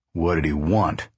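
low, insistent question; demanding. A narrator's reading. Pa pressing Ma about the manager, demanding. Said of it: "Pa demanded again".

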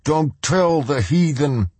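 high, slow fanatic yell; shouted. Acted. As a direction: drag out the warning against the heathen. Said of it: "the man on the ground shouted".

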